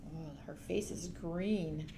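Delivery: ordinary delivery, nothing marked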